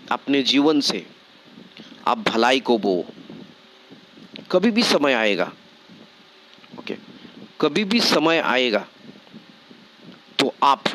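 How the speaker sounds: low, steady sermon exhortation; preacher pressing the point home; earnest preaching. Acted. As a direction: urge the congregation to sow goodness. Preaching exhortation, lower pitch.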